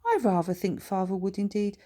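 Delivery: lightly posh, fast smug remark; smug posh boy. Acted. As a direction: sneer politely at father. Posh son mocking father.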